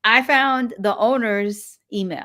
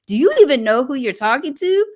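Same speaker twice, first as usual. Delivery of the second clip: indignant gatekeeper; 6 semitones higher, 7 dB louder than usual. Retold or imitated. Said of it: "She's like".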